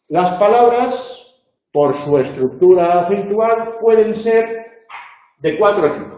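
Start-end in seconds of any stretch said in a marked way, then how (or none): none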